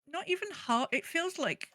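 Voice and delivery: ordinary talk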